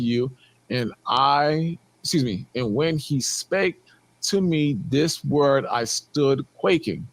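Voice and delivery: slow, low solemn reading; scripture reading. Acted. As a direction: read the verse slowly and gravely. Reads scripture aloud again.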